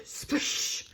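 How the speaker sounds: breathy splish noise; splash sound. A narrator's reading. Bunnies jumping into stream, splish sound. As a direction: make the splash with your breath.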